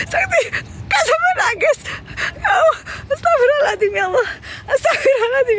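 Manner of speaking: high, squealing, tearful laughter in the voice; laughing to tears. Real, not acted. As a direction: gasp out pleas through helpless laughter. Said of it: "udah lama banget nggak ketawa sampai nangis". (tertawa sampai nangis)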